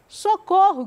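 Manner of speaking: Socorro yelled high and loud; shouted cry for help. A narrator's reading. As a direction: cry out Socorro from the bottom of a well. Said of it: "gritou ele". (grito alto de socorro)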